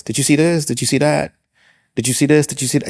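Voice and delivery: imitating a promoter listing landmarks; excited promoter. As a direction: rattle off the sights like an eager host.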